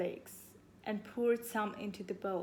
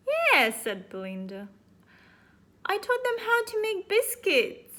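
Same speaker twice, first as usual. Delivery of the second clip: put-on high girl voice; 11 semitones higher, 8 dB louder than usual.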